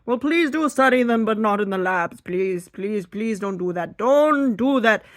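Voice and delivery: loud, high mock protest chant; hammed-up activist outcry; sign-waving protester. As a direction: plead like an animal rights picketer. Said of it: "hold signs and say".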